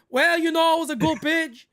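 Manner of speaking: lightly hammed-up postgame player answer; mock player interview. Co-host joking about Wells' postgame interview, mock player voice. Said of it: "He said".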